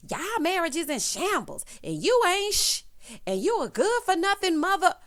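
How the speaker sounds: sassy mock put-down; mock harsh callout. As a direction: dish out a hammed-up scolding. Mocking a friend's harsh callout.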